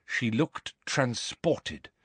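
plain reading voice

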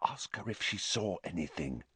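very soft secretive aside; hushed under-the-breath prompt; mouthed, barely voiced. A narrator's reading. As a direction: whisper it so only a friend catches it. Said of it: "Harry mouthed at Hermione".

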